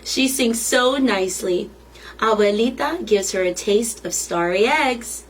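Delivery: usual speaking voice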